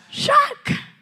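put-on "Jack" cried very high in an American accent, drawn out; hammed-up American movie call of "Jack"; American-accented cry. Preacher imitating Rose calling Jack in an American accent. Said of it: "póngale acento gringo".

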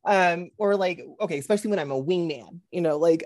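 ordinary delivery

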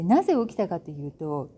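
ordinary talk

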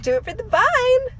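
high, shrill meme shout. Retold or imitated. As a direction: squeal it like the Vine meme. Quoting a meme.

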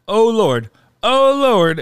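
loud, high exclamation; retold panicked cry. A woman frantically searching the restaurant, retold as a loud cry. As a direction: imitate someone crying out in alarm.

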